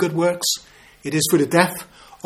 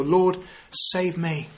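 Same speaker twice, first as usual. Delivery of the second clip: each word spaced out slowly and softly; slow, deliberate; 4 dB quieter, much slower than usual. Shown as a demonstration. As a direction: let the three words sink in. Quoting the three-word prayer.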